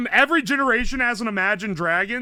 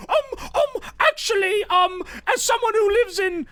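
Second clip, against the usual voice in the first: high-pitched mock British pedant; British accent; 11 semitones higher than usual. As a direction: put on a high British voice to correct someone. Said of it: "I'm doing a British accent for this".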